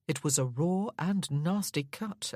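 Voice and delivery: plain reading voice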